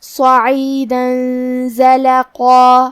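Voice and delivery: صعیدا with a heavy ص and nasal ikhfa into زلقا; slow recited demo. Shown as a demonstration. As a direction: chant very slowly, heavy ص and ق. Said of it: "غنہ کے ساتھ ادا کریں گے".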